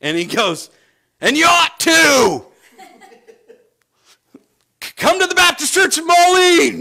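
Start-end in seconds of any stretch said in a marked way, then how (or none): 1.2-2.5 slow, high, booming holler; shouting back. Retold or imitated. Shopper shouts back down the aisle. 4.8-6.8 high, very loud comic yell; mock shouted invitation. Joke invitation yelled across the aisle.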